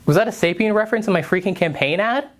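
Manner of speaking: exasperated. Real, not acted. Breaking character, exasperated.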